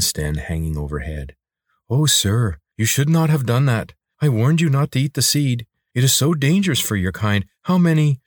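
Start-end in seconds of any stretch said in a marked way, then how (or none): 1.9-8.2 higher-pitched creature full of concern; worried lizard, scolding. Acted. Lizard warns sir against eating the seed, worried. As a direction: chide a master in a lifted, anxious voice.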